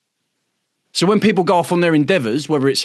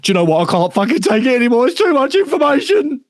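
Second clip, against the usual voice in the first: much higher, put-on voice of someone at breaking point; overwhelmed, fed-up member; 11 semitones higher, 3 dB louder than usual. Member overwhelmed by all the information, high fed-up whine. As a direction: squeak out being overwhelmed. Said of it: "To come back and just go".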